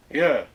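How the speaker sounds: you said as a short falling Yeah; Western you. Shown as a demonstration. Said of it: "It's yeah".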